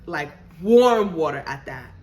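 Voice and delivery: ordinary talk, nothing marked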